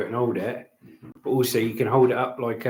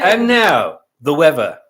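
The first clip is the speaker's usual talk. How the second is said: mock TV announcer; 3 semitones higher, 10 dB louder, slower than usual. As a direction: announce the weather segment with big fanfare. Introduces partner as weather presenter.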